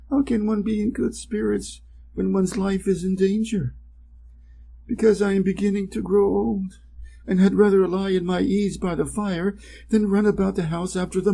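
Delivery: put-on a worried old cat pleading for sympathy; plaintive old cat. Cat voiced in fairy tale.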